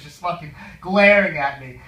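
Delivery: ordinary delivery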